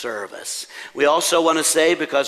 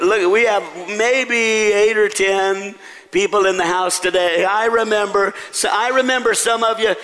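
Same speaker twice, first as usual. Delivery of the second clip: high-pitched, animated calling out; rallying the crowd; 6 semitones higher, 3 dB louder than usual. Real, not acted. Pastor polling congregation on former Kmart workers, rallying them playfully. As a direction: call out to the whole room for a show of hands.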